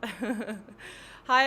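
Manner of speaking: ordinary delivery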